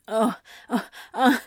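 breathless gasps rising high and loud; gasping. A narrator's reading. Anthony overwhelmed by Crowley, breathless gasping. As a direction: gasp it out, overwhelmed. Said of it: "Anthony gasped".

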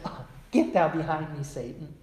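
mock gentle rebuke. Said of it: "I'm sure that's just how he said it".

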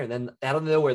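normal talking voice

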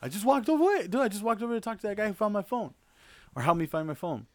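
high, fast, carefree voice; imitating Mike. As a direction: brush it off like nothing happened. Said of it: "He goes".

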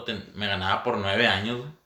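normal talking voice

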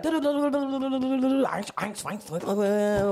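high, rapid nonsense syllables mimicking German; mock German coach babble. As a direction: rattle off fake German like the coach. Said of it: "he would be like".

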